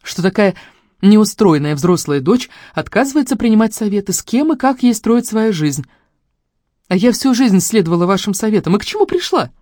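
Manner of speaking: high-pitched, heated protest; indignant outburst. A narrator's reading. Daughter rebels against family advice, indignant.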